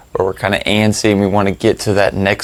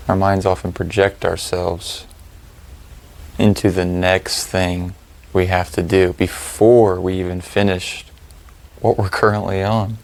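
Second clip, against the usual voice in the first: calm, spacious delivery, letting each phrase settle; guided meditation; 8 dB quieter than usual. Real, not acted. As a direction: lead them through the breathing, unhurried and even.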